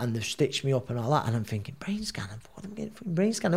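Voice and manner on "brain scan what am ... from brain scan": high, half-whispered disbelief; incredulous thought. Retold or imitated. Boxer to himself about the brain scan, a high incredulous half-whisper. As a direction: scoff at the idea under your breath.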